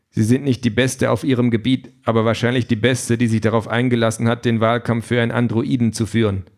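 ordinary delivery, nothing marked

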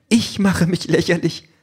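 indignant. A narrator's reading. Wife answering husband who says she looks ridiculous, indignant. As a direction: throw the accusation back, pitched high.

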